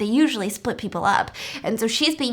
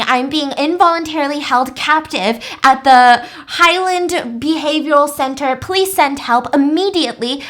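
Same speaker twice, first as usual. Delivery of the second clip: raised, urgent call for rescue; loud desperate plea for help; urgent phone plea; 3 semitones higher, 6 dB louder than usual. Retold or imitated. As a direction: plead loudly down the phone line.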